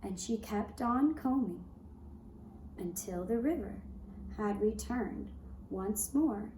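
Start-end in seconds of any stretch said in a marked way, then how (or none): none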